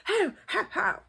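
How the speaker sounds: high laughing crowd cheer; mock crowd cheer. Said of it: "the crowd goes".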